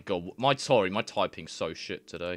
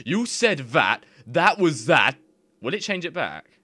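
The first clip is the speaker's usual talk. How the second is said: excited; 7 semitones higher, 7 dB louder than usual. Real, not acted. Reacting to translation result.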